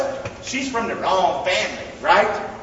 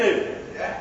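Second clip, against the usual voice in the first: usual speaking voice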